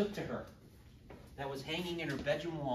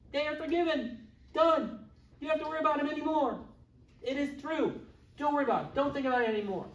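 much higher and louder, rushing reassurance; forceful absolution; 8 semitones higher, 5 dB louder, faster than usual. Retold or imitated. Pastor telling the congregation their sins are forgiven, emphatic and loud. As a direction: proclaim it done, no more worry.

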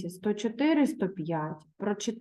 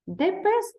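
sudden high hopeful exclaim; Martino's hopeful thought; 12 semitones higher than usual. Acted. Boy spots a dog in the woods, high hopeful cry. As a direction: voice jumps up with a boy's hope. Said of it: "вирішив Мартіно".

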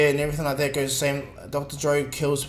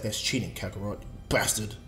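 lower, softer resentful grumble; grumbling Vegeta; 6 semitones lower, 5 dB quieter than usual. Retold or imitated. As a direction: mutter it like a sore rival. Vegeta grumbling about Goku.

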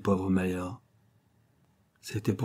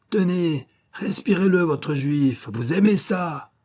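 much higher, louder, slow at the end; raging taunt; 9 semitones higher, 4 dB louder, slower than usual. A narrator's reading. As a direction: grind it in with cruel rage. Schiller in a rage.

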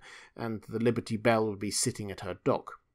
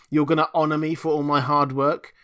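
loud, higher belligerent sarcasm; 5 semitones higher, 8 dB louder than usual. Acted. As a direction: throw it back at them, defiant. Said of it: "feeling belligerent".

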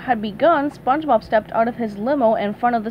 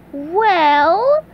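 modest; 7 semitones higher, 6 dB louder than usual. Acted. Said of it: "said SpongeBob modestly".

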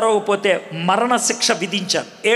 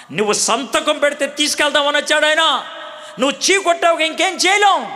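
high, raised, reproachful telling-off; scornful scolding; 8 semitones higher than usual. Acted. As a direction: jailer scolding the prisoner who spat on mercy. Jailer scolds the prisoner.

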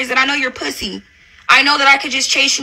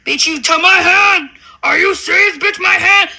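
mocking her; 3 semitones higher than usual.

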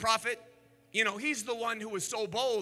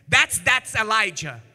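ordinary delivery

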